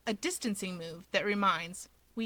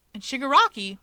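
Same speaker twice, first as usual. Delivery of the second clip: plain reading voice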